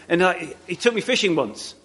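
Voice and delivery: ordinary talk, nothing marked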